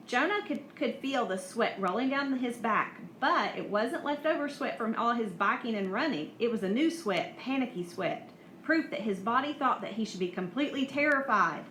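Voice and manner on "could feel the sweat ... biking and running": ordinary delivery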